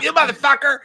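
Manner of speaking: high, loud cartoon-kid rage; Cartman. Friend imitating Andrew mad after the tackle, Cartman yell. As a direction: shout it in a squealing cartoon voice. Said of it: "dead on Cartman".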